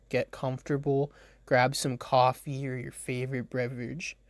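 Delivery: usual speaking voice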